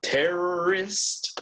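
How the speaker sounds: terrorist drawn out very slowly, each sound clear; slow teacher model of terrorist; slow model word. Shown as a demonstration. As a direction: spell out terrorist slowly for the class. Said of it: "This would be a".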